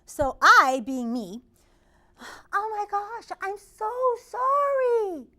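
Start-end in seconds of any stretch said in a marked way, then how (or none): 2.5-5.3 high, drawn-out mock apology; sing-song sorry out the window; retold yell out the window. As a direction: call out a stretched sorry. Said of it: "I yell out the window".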